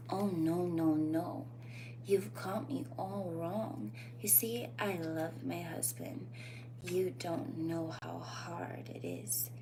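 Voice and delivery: soft, slow and breathy, half whispered; sultry femme fatale. Shown as a demonstration. Demonstrating the Jessica Rabbit line to viewers, soft and sultry. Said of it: "study that".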